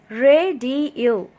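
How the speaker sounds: syllable by syllable. Shown as a demonstration. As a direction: sound out Radio in three parts. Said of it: "that was three syllables".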